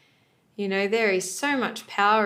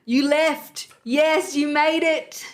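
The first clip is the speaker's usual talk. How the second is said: higher and louder, proud cheer; cheering placenta; 5 semitones higher, 5 dB louder, slower than usual. Retold or imitated. Placenta cheering the newborn baby, higher proud cheer. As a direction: celebrate like a proud parent at a finish line.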